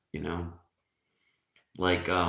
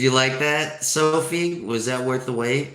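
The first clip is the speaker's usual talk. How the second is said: louder, higher-pitched eager question; calling out; 6 semitones higher, 7 dB louder than usual. Real, not acted. Joke teller asks a listener about the joke, calling out. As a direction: project it to a listener after the punchline. Calls out to Sophie after punchline.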